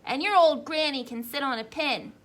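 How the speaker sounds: mock yell. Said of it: "the third little pig yelled".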